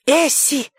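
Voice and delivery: put-on a boy squealing in protest. A narrator's reading. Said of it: "взвизгнул Хилли".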